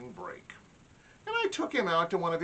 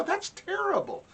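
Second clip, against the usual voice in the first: mock outrage. Dad outraged, mock hurt.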